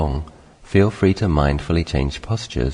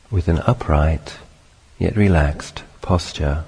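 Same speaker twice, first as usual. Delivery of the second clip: measured meditation guidance in short phrases; unhurried meditation teacher, spacious phrasing; guided meditation. Acted. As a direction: guide them gently through it, long pauses between phrases.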